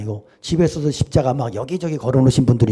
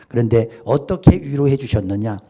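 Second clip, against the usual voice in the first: normal talking voice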